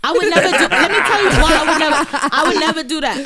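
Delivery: high, loud denial; emphatic protest. Real, not acted. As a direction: insist loudly over the laughter. Emphatic denial of body shaming.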